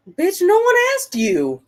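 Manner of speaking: sharp high scolding snap; irritated snap at a talking gadget; snapping at Alexa. Real, not acted. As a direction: tell the smart speaker off, loud and high.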